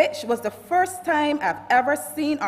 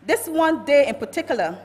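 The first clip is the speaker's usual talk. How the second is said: normal talking voice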